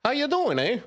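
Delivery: high mock Canadian "eh"; mock Canadian accent. Mock Canadian accent, teasing the listener about hockey. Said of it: "they like to".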